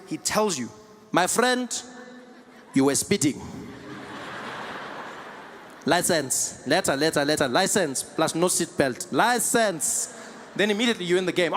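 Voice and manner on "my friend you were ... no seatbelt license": put-on thick South African officer's accent; blunt traffic cop with strong South African accent; South African metro cop. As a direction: demand papers in heavy South African English. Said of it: "the Metro Police comes there".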